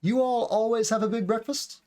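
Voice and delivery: high question tune rising to "breakfast". Shown as a demonstration. Teacher shows learners a question without "do", question tone. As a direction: make it a question by inflection alone. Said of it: "it's a question tone".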